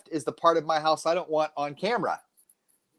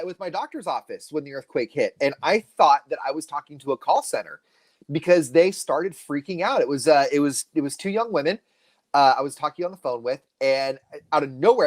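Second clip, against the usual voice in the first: normal talking voice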